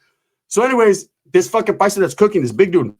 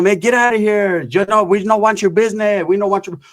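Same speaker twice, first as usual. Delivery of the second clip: higher, imitated Mexican-accented telling-off; mock Mexican accent; 3 semitones higher than usual. Cook turns them away through the window, mock Mexican accent.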